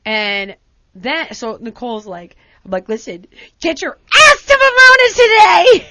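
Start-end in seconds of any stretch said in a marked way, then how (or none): 2.9-5.9 high, loud, strained yell; a loud mock-desperate yell; yelling order. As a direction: shout the order, half laughing, half crying. Yelling at Nicole to come.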